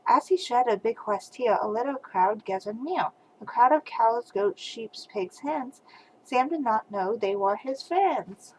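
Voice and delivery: usual speaking voice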